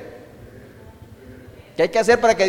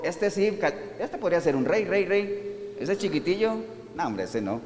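musing to himself; 9 dB quieter than usual. Retold or imitated. Samuel judging the brothers.

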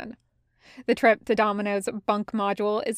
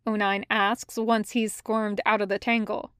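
plain reading voice

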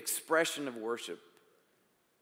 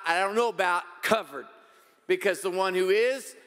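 raised, higher preacher voice; emphatic preaching; 4 semitones higher, 5 dB louder than usual. Real, not acted. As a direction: preach it out with conviction, punching key words.

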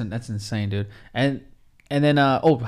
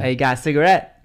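light mock Boston accent; terrible Boston accent. Friend teasing about picking up girls with cigarettes, mock Boston accent. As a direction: ask it in a bad, half-put-on Boston accent. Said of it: "That's my terrible Boston accent".

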